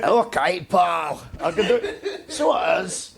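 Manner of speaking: put-on playful Scottish accent with a laugh. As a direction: slip into a jokey Scottish accent on request. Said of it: "Give me the Scottish accent".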